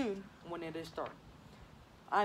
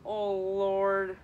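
loud groan; 8 dB louder than usual. Acted. Nurse complains of aching head.

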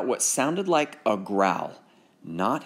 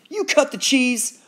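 high, louder taunt; mocking accusation; 13 semitones higher, 4 dB louder than usual. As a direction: call it out across the room. Bully accusing loudly.

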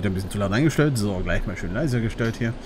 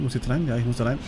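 ordinary talk

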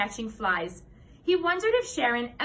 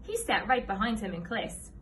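plain reading voice

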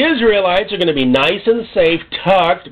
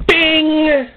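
bell ding; 10 semitones higher than usual. Acted. Sound of the bell.

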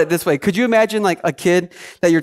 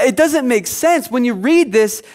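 ordinary delivery

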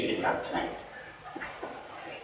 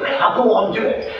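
put-on coaxing old lady; 8 semitones higher than usual. Old lady urging again.

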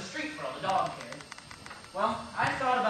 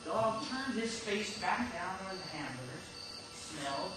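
hushed suspense; 9 dB quieter than usual. Acted. Jerry telling Peter how the dog sniffed, hushed suspense. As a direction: draw them in quietly before the pounce.

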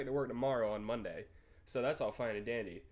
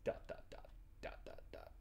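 whispered dots; 10 dB quieter than usual. Pretend. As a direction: whisper the dots, unvoiced and fading. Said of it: "reading typing dots aloud".

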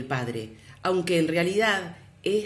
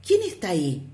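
put-on child's question; faster than usual. A narrator's reading. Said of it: "el niño preguntó".

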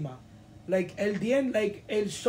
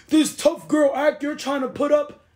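mocking quote; 6 semitones higher, 6 dB louder than usual. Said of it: "And then you say".

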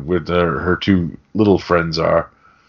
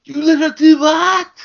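much higher and louder, shrill panic; freaking out Gump; 5 dB louder than usual. Retold or imitated. Mocking Gump's reaction to Lily touching the unicorn, squeaky and much higher. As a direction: flip out in a tiny squeaky voice. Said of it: "The fucking voice fucking kills me".

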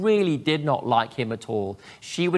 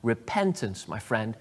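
ordinary talk, nothing marked

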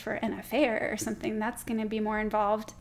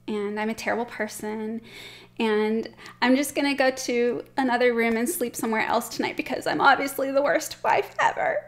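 hammed-up self-blame building louder; self-pitying apology; 4 dB louder than usual. Shown as a demonstration. Wife declaring herself the worst wife, dramatically self-pitying. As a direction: wallow in mock self-pity, getting more worked up. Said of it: "examples of how not to apologize".

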